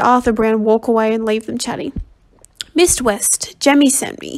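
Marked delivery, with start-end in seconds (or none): none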